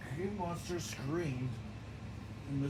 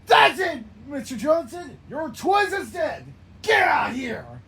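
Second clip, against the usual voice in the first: loud, high, crying angry yells; furious shouting; 9 semitones higher, 13 dB louder than usual. Acted. Character calls out Mister Johnson over dead twins, furious shouting. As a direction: scream for revenge.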